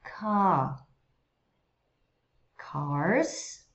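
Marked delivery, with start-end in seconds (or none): 2.6-3.7 "Cars" with a clear curled R; American "Cars". Shown as a demonstration. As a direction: roll the tongue back on the R of "Cars". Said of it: "Notice the difference".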